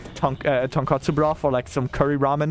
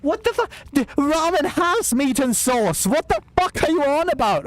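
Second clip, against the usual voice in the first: outraged disbelief; 12 semitones higher than usual. Real, not acted. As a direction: shriek your outrage, dragging it out. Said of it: "I should not be getting this emotional".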